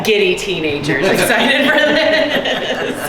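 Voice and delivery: slow words broken up by laughter; giddy laughing. Real, not acted. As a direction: laugh through it, giddy and excited. Laughs at own giddy excitement.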